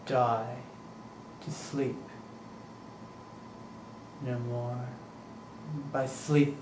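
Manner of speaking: very soft, musing delivery with long pauses; hushed soliloquy. Acted. Hamlet alone weighing death and sleep, hushed soliloquy. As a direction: think aloud almost under the breath.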